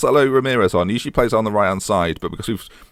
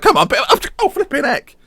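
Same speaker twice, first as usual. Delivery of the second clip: frustrated keeper; 3 dB louder than usual. Retold or imitated. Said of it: "The keeper's like".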